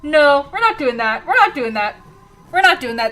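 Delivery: loud, high, frightened protest; panicked denial. Real, not acted. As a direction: insist loudly while losing your nerve. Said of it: "I'm freaking out".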